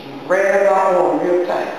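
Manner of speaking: ordinary talk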